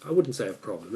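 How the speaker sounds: ordinary talk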